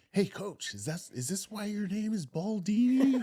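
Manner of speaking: put-on high-pitched kid's question, rising; little girl. Little girl calling to her soccer coach, high imitated child voice. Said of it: "and then she goes".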